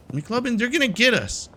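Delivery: high, loud alarmed warning; sudden fear, voice jumps up; panicked cry. Real, not acted. Gamer warning his buyer about the cops, panicked. Cops near, curfew starting.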